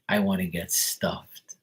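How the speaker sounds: slow whisper as the turkey lady; imitating a slow suggestive whisper. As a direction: drop into a slow whisper for the punchline. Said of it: "And she goes".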